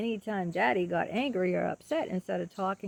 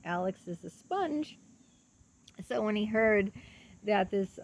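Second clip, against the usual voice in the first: ordinary talk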